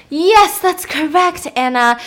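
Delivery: loud, high burst of praise; hammed-up proud dad; emphatic approval. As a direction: exclaim it like a thrilled quizmaster. Pretend dad praises correct answer.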